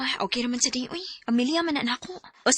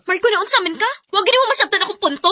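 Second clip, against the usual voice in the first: louder, higher and fast; angry retort; 6 semitones higher, 8 dB louder, faster than usual. Acted. Wife shouting back at her husband during their fight. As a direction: fire it back at him.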